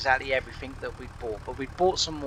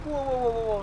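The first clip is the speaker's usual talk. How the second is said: alarmed whoa; 11 semitones higher, faster than usual. Retold or imitated. Stopping the driver leaving.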